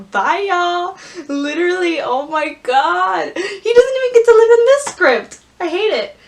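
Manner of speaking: high, loud near-crying rant; outraged. Real, not acted. Fan furious at Ben's fate, high and loud. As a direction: wail about the script, fired up. Fan upset Ben is cut off.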